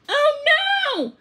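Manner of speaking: alarmed. A narrator's reading. Storyteller reacts as the toddler nears the stairs, alarmed. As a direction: gasp it out in alarm. Dog races to save toddler.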